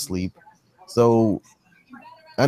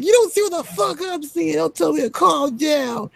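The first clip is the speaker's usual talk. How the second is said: hysterical wife. Retold or imitated. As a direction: mimic a sobbing, frantic woman. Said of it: "screaming and crying".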